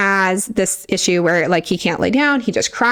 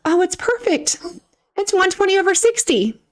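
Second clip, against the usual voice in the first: high, breezy mock-doctor voice, pitched up; 10 semitones higher than usual. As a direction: play the dismissive doctor, smug and light, in a high voice. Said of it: "He said".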